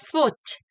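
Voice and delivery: Foot with a long hissed F before the vowel. Shown as a demonstration. Teacher demonstrates holding the F, long hiss. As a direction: stretch the F of Foot. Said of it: "Hold the F sound for the first four beats".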